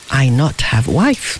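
ordinary delivery

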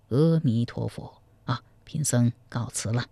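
a monk's calm, low leave-taking; old monk. Acted. Monk bows out from the groom, low and soft.

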